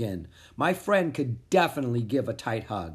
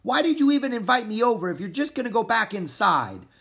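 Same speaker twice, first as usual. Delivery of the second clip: raised, higher shouting voice; 9 semitones higher, 4 dB louder, faster than usual. Acted. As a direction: yell it at a friend, upset. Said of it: "Pip shouted at me".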